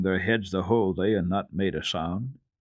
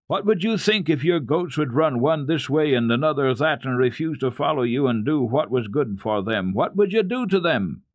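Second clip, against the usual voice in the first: stern scolding; 6 semitones higher, 4 dB louder than usual. Acted. Uncle scolds truant boy.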